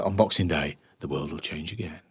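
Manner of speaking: low, hushed, solemn pronouncement; a guru's grave prophecy, dropping away softly; serious mode. Retold or imitated. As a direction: intone it gravely, voice sinking. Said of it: "he goes into his serious mode again".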